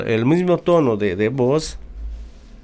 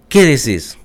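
curt tone. Retold or imitated. Wife answering her husband now, curt and blunt. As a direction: snap it back without the sweetness. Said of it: "Cambia la, el tono de la voz".

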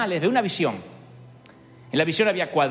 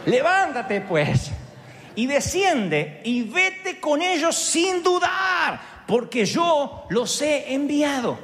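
slow, higher, shouted Levántate pues; commanding Spirit; 7 semitones higher, much slower than usual. Acted. The Spirit commands Peter to go, slow shout. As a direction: order Peter up with slow force.

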